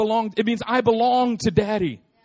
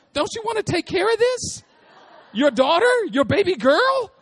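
put-on much higher, sweet pleading little girl; little daughter's voice; 11 semitones higher, 3 dB louder than usual. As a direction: beg daddy like a tiny child, louder on the end. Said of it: "she did it just like that too".

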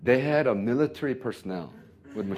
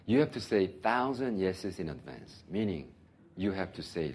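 ordinary talk, nothing marked

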